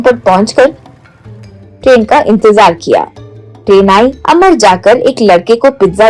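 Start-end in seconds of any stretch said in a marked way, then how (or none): none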